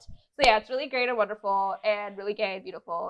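ordinary delivery

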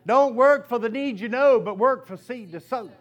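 a preacher's punchline, loud and firm; preached saying. Acted. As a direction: proclaim it to the congregation. Preacher proclaims key saying.

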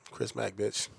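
Chris Mack line. Pretend. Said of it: "all I heard was".

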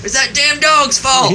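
loud, slow, high hillbilly yell; Joe Dirt. Retold or imitated. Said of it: "the guy in Joe Dirt".

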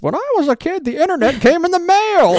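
put-on high, loud old lady voice. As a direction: quote it as a little old lady. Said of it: "my six-year-old in an old lady voice".

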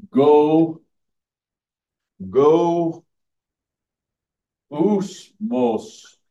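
loud, stretched out "go, go, usmos" broken into parts; drawn-out Greek word. Shown as a demonstration. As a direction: sound out "go, go, usmos" syllable by syllable. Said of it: "you have to say it like that".

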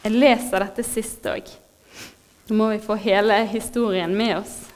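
ordinary talk, nothing marked

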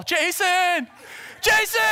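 high, loud frantic call; yelling. Retold or imitated. Preacher re-enacting a frantic phone call to a friend, yelling. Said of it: "I'm yelling".

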